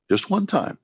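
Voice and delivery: high lifted coaxing line; a playful pleading lift; coaxing plea. Retold or imitated. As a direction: coax her gently, just once. Pleading with Wendy to visit.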